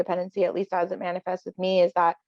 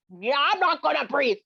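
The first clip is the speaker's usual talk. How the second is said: imitating a stubborn refuser, pitched up; mock stubborn refusal; 6 semitones higher than usual. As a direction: play a sulky person refusing to breathe. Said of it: "never had someone".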